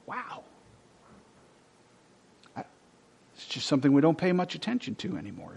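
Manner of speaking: ordinary talk, nothing marked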